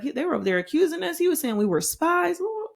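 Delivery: a worried son spilling the news fast and high; alarmed report. Retold or imitated. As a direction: blurt it to their father in a rush, high. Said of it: "So he's like".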